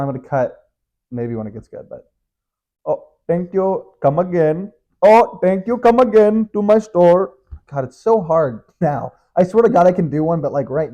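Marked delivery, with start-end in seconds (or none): 2.8-4.7 slow, higher mock Indian accent. Shown as a demonstration. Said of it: "Indian accent". 5.0-7.3 loud, high, hammed-up Indian accent. Shown as a demonstration. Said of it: "Indian accent".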